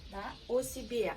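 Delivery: ordinary talk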